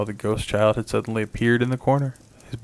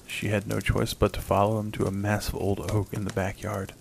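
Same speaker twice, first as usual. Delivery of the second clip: ordinary delivery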